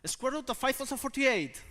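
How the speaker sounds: high, loud snap question; revengeful quizzing. Acted. Geography student getting back at a maths student, revengeful quizzing.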